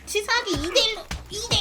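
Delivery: indignant yell. Real, not acted. Outraged at being ganged up.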